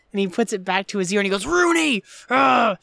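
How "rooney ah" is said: loud, higher mock-angry yell; retold growl. Imitating Cameron raging down the phone, a mock growling shout. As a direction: bellow the name, then a frustrated cry. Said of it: "he, like, growls on the phone".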